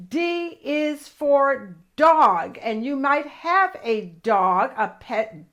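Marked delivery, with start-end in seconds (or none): 0.1-2.6 "D is for dog" drawn out very slowly; slow teaching. Shown as a demonstration. Teaching kids the word dog, very slow. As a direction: spell it out slowly for little ones. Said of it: "teaching slowly".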